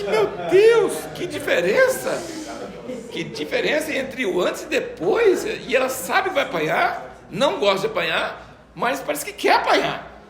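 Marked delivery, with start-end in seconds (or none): 0.0-5.5 put-on high, slow, beaming child's voice; relieved child. Toddler marvelling after the spanking, high and slow. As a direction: exclaim like a toddler amazed at the change. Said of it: "Fala assim".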